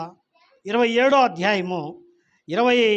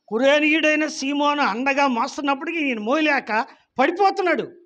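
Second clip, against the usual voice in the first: raised, pressing sermon voice; impassioned preaching; 5 semitones higher, 4 dB louder than usual. Acted. Preacher describing Jesus falling under the cross, fervent. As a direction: preach the scene with rising force.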